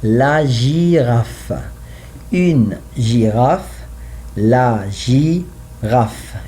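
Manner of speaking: la girafe and une girafe very slowly; slow drill. Shown as a demonstration. Teacher drills la girafe and une girafe slowly. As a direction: drill each word slowly for the class. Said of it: "when I say the".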